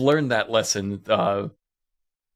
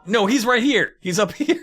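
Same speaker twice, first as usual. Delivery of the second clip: mock flustered protest; 9 semitones higher than usual. Team defending themselves to Buck, mock flustered protest. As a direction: insist it, hammed-up and flustered.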